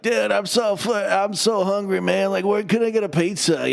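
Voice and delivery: high, drawn-out surfer-dude whine; whiny hungry turtle dude; Michelangelo. Retold or imitated. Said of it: "he just talks like that".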